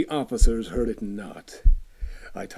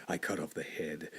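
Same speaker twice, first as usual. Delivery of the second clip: a hushed, breathy confession; whisper; 4 semitones lower, 13 dB quieter than usual. A narrator's reading. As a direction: barely voice it, like a dreadful secret. Narrator recounts dismembering corpse.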